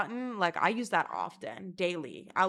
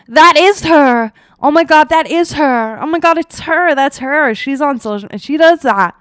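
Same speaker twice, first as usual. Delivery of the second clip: excited outburst; 7 semitones higher, 21 dB louder than usual. Retold or imitated. Offline friend recognizing her in public, excited yelling. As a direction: yell it like an overexcited friend.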